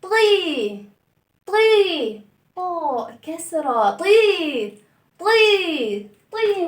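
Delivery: طي with a thin bright ee; broken ee sound. Shown as a demonstration. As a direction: break the sound into طي. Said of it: "بصوا عامل إزاي".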